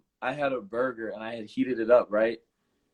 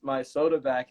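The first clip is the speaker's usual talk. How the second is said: usual speaking voice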